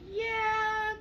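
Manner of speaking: very high drawn-out doubtful yeah; a skeptical squeak of a yeah; sarcastic yeah. Retold or imitated. Mom doubts blood is cool.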